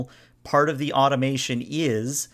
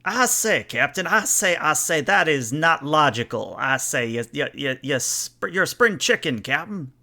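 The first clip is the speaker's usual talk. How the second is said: lightly hammed-up Foghorn Leghorn doing a Vulcan; Foghorn Leghorn accent. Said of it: "you're gonna have to do a Foghorn Leghorn accent".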